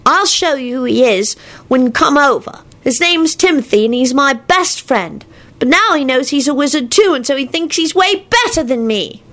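put-on high kid voice; earnest little boy. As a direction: explain it like a small boy. Voices the small boy Teddy.

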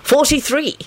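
bright high-pitched answer; cheerful. A narrator's reading. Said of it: "he said cheerfully".

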